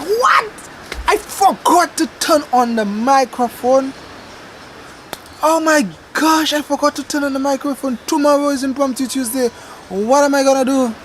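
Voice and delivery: hammed-up horror at your own blunder; re-enacted dismay. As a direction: wail it in exaggerated disbelief. Re-enacts discovering the forgotten microphone.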